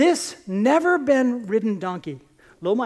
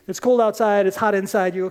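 ordinary talk

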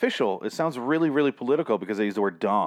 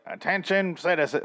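Joker-style announcement; 9 semitones higher than usual. Retold or imitated. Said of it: "like the Joker showing up".

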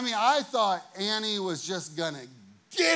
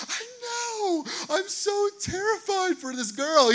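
much higher, crying fake-fear whimper; mock panicked whine; 5 dB louder, slower than usual. Youth pastor panicking to staff, higher crying whine. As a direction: wail it like you're about to cry.

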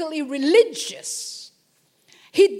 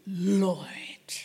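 hushed whisper, lower; 8 semitones lower than usual. Acted. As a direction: breathe the word Lord in awe. Saul whispering Lord.

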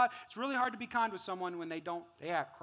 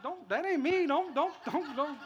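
high, flustered mock protest; God refusing; 9 semitones higher, 6 dB louder than usual. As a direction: keep waving it off in repeated don'ts. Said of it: "And God's like".

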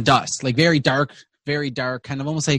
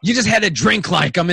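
higher, lively dwarf; dwarf reassuring; 4 semitones higher, 3 dB louder than usual. Acted. Dwarf tells Boom Boom just to drink, high-voiced.

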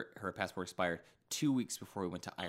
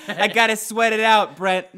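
calling out high and loud in a silly voice; loud put-on voice; 12 semitones higher, 18 dB louder than usual. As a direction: holler to Brent like a goofy sauna guest. Silly sauna skit.

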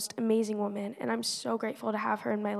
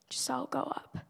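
soft urging whisper; inner whisper; 2 semitones higher, 8 dB quieter than usual. Retold or imitated. Inner voice urging Giselle to the altar, hushed whisper. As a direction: breathe it like a voice inside.

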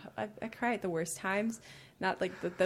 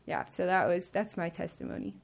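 ordinary delivery

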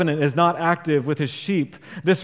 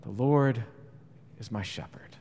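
soft, hushed, fading into a whisper; calming whisper; 6 semitones lower, 8 dB quieter than usual. Shown as a demonstration. Pastor showing the congregation how calming the verse is, whispered. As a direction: let the verse settle like a lullaby. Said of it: "the words are immensely calming".

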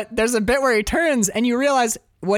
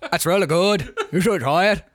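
mock Liverpool accent. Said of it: "he goes".

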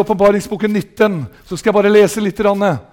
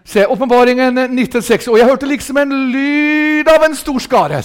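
raised, ringing preacher's reading; fervent high-pitched scripture reading; proclaiming scripture; 4 semitones higher than usual. Real, not acted. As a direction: read the verse out as a rising proclamation. Proclaims the verse aloud.

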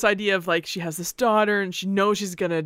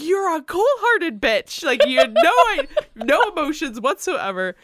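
higher, slow, indignant put-on voice; mock accusing sister-in-law; 9 semitones higher, 4 dB louder than usual. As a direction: ham up the outraged sister-in-law. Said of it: "she's like".

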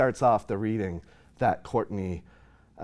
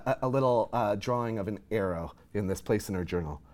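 ordinary talk

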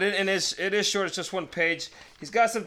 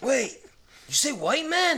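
a light mock Mexican accent, pitched up; joking Mexican accent, higher; 7 semitones higher than usual. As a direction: play the Mexican accent for a laugh, just a touch. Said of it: "best Mexican accent I think I've ever done".

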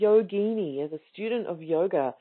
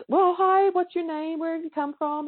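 friendly greeting; 8 semitones higher than usual. Retold or imitated.

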